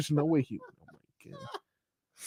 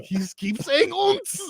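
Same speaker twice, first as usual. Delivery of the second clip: very high, slow mock German voice; imagined German counterpart; much slower than usual. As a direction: play a dismayed German, pitched way up and dragged out. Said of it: "there's a German Robbie who's like".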